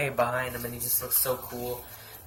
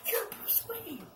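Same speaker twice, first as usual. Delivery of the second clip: high, hushed squeak of realisation; mock astonishment; 8 dB quieter, slower than usual. Gordon reacting to Batman's slip, in mock astonishment. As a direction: squeak it softly, mocking a sudden discovery.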